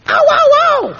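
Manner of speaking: put-on a hurt boy whimpering out loud; yelps of pain. Bunter rubbing his knuckles.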